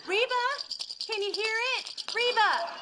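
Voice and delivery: high, sing-song coaxing; coaxing call. Acted. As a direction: call her like a pet to a toy. Teaching Reba to catch ball.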